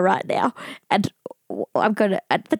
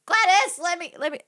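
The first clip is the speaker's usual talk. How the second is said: put-on old lady voice; 8 semitones higher than usual. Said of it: "imitating an old lady".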